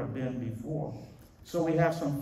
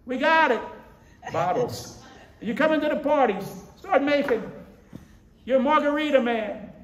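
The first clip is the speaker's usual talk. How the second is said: high, loud egging-on from drinking buddies; rowdy party friends; 9 dB louder than usual. Retold or imitated. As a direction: crow it like a party crowd. Voicing friends urging drinks.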